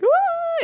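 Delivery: very high imitated crying wail; mock crying wail. Retelling her own crying to friends, mock wail. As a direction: put on a high-pitched comic bawl. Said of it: "like, crying. Like, I'm like".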